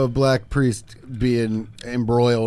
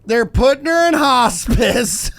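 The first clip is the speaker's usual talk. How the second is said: high, slow, louder mock old lady, laughing; imitating the caller; 9 semitones higher, 5 dB louder, much slower than usual. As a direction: deliver the grim news half-giggling. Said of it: "And then she went".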